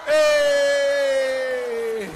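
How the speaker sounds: very loud, high, long-held whoop; crowd cheer. Real, not acted. Host greeting mainland China guests, whooping cheer.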